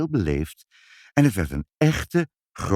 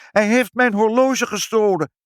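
very high, loud piping accusation; indignant shrill piping; shrill squeak; 13 semitones higher, 6 dB louder than usual. A narrator's reading. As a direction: squeal it shrilly, far above your own voice. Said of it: "piepte meneer Pinkepank schril".

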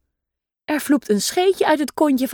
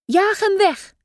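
shouting; 8 semitones higher, slower than usual. A narrator's reading. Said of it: "schreeuwt mama meeuw".

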